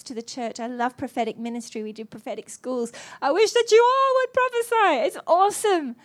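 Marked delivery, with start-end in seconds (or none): 3.2-6.0 passionate preaching. Real, not acted. Preaching at full force.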